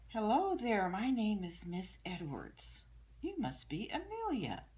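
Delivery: high, loud, friendly teacher voice; bright teacher greeting. Acted. Teacher welcoming Amelia to class, high and bright. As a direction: sing it out like a cheerful teacher.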